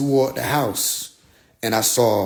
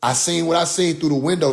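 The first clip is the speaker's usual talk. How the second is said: usual speaking voice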